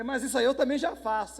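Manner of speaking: high, loud comic protest; retold indignant comeback at full volume; mock exasperation. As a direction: burst out, let down by the advice. Retold exasperated reply to disappointing answer.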